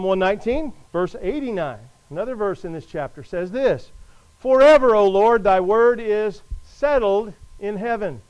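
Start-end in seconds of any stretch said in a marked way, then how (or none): 4.4-8.2 loud opening, slowing to a measured finish; emphatic scripture reading. Real, not acted. Preacher reads the psalm to the congregation, emphatic and deliberate. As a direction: proclaim the verse, stretching it out.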